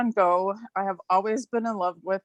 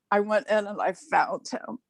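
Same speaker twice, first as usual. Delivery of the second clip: normal talking voice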